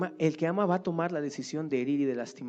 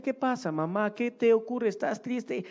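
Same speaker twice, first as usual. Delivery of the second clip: put-on innocent little child; 7 semitones higher than usual. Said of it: "el pequeño pues pregunta inocentemente".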